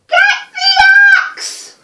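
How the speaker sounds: loud, high, slow desperate yell. A narrator's reading. Jack yelling down to his mother from the beanstalk, loud and high. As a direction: call down to mum at the top of your lungs. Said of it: "he yelled".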